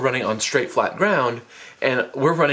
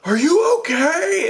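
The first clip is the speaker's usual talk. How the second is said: friends laughing then worried. Retold or imitated. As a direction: play giggly friends who suddenly notice blood. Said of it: "They're like".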